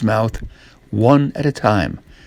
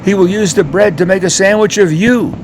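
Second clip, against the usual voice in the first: higher, urgent warning; frightened warning; 7 semitones higher, 3 dB louder, faster than usual. Acted. Woman warning Jack, frightened. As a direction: scare him into hiding.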